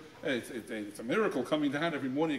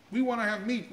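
complaining; 6 semitones higher than usual. Retold or imitated. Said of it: "They were complaining".

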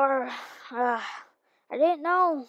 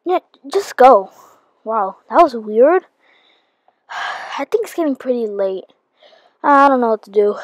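loud; 12 dB louder than usual. Acted. Shouting at visitor, then to self.

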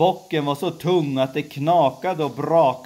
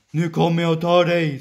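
louder, fast troll threat; troll's yell; 6 semitones higher, 6 dB louder, faster than usual. Acted. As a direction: bark it out like a troll under the bridge. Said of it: "skrek trollet". (trollets skrik, högre och snabbare)

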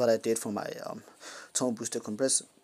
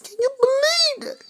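very high-pitched squeal; mock disbelief; 5 dB louder than usual. Mock disbelief at people's ignorance.

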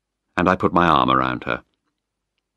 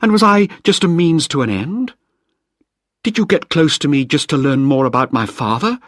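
higher, louder wounded accusation; Penny confronting Malcolm, louder and higher in hurt anger; hurt fury; 9 semitones higher, 4 dB louder than usual. Acted. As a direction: demand the truth, stung.

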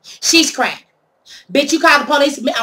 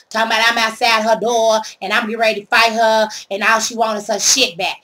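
ordinary talk

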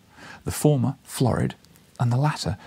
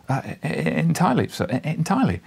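higher, stammering but insistent; emphatic; 7 semitones higher than usual. A narrator's reading. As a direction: force the agreement out. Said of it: "I was very emphatic".

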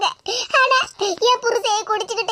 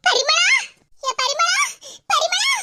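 excited calling out; 6 semitones higher, slower than usual. Acted. Calling out to a friend on road.

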